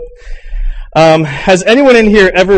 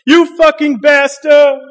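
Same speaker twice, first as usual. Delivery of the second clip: higher, louder, slow drawn-out yell of "You fucking bastard!"; mock driver's yell; 11 semitones higher, 4 dB louder, slower than usual. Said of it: "an angry driver screams".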